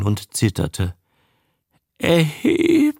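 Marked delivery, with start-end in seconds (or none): 2.0-2.9 high, broken sob on the name; sobbing. A narrator's reading. Trembling woman curled on the ground, sobbing to her man. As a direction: sob his name like a frightened child. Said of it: "schluchzte sie".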